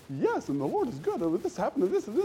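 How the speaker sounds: high, bouncing, relentlessly cheerful voice; Tigger. Retold or imitated. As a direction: bubble over with swooping high pitch. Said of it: "Tigger from Winnie the Pooh was talking".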